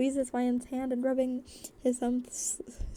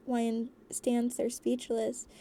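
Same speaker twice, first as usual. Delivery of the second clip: usual speaking voice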